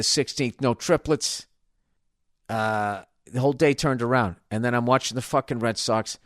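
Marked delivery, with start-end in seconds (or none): none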